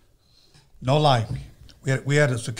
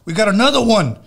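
louder, higher call for help; 7 semitones higher, 7 dB louder than usual. Retold or imitated. To the bodyguard Bundy about another intruder, calling out. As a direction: shout it across to the bodyguard.

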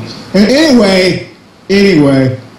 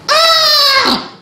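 shrill mock scream. Mocking the character's scream.

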